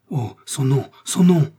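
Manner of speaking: rising to a high, loud cry on son nom; anguished cry. A narrator's reading. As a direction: plead desperately for the name. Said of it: "s'écria Felton".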